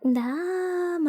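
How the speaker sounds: normal talking voice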